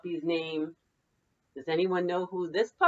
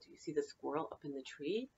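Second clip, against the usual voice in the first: ordinary delivery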